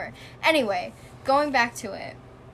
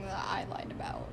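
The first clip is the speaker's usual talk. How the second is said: hushed suspicious whisper; 2 semitones lower, 8 dB quieter than usual. Real, not acted. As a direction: whisper it warily.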